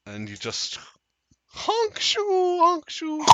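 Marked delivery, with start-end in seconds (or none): 1.5-2.6 whispered snore sounds; breathy snoring honk. Acted. GM mimes Ignis snoring. 2.6-3.2 voiced snore sounds; snoring. GM mimes Ignis snoring.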